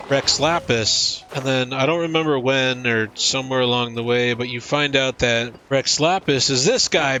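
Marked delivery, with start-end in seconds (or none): none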